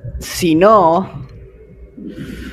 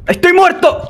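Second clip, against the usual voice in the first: much higher, shouted cry of defeat; dismayed yell. Real, not acted. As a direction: yell it as you fall. Dies in game.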